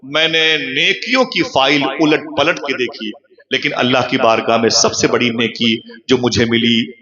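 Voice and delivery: ordinary talk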